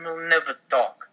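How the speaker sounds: ordinary talk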